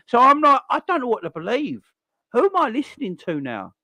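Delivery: high, loud burst of disbelief; exasperated outburst. Real, not acted. Fan baffled by manager's words, high exasperated outburst. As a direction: throw your hands up, pitched high.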